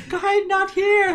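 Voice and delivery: high, slow, giggly woman's voice; lady voice. Pretend. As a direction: play a lady through the laughter. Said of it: "gave yourself a lady voice".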